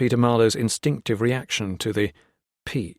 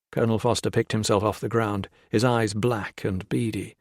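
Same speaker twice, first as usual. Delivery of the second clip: plain reading voice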